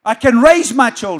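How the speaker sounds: loud, forceful preaching; rousing declaration. Real, not acted. Preacher telling parents what they can do, rousing declaration. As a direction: proclaim it hard to the congregation.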